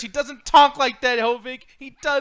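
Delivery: frustrated insistence; exasperated rant. Real, not acted. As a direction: push back hard at him. Ranting at friend.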